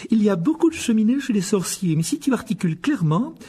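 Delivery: a brisk mother of many sons; Mrs Weasley. Acted. Molly voiced. (voix de mère plus aiguë)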